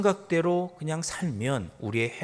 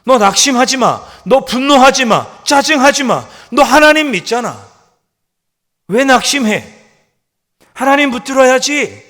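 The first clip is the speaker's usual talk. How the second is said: loud, high, sharp scolding; rebuking his soul; 11 semitones higher, 16 dB louder than usual. Acted. Preacher scolding his own soul, loud and high. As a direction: order your soul not to despair. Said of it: "꾸짖죠".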